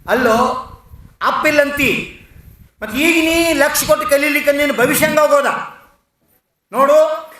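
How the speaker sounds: high, loud, pressing rebuke; roaring scolding. A narrator's reading. Teacher scolds a pupil about his future, roaring. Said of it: "ಗರ್ಜಿಸ್ತಾರ".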